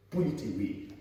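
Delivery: normal talking voice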